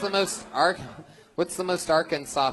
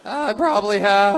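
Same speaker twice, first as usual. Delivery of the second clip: high, loud mimic of the guest's voice; mocking childish voice; 7 semitones higher, 6 dB louder than usual. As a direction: echo him like a whiny kid. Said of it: "you sound like a young child".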